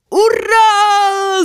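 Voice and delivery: high, loud cry of triumph; joyful shout. Acted. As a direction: yell out in a dream. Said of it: "закричал Вася".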